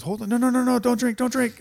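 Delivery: high, loud urgent doctor; panicked warning, way up high. Retold or imitated. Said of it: "this doctor comes up and he goes".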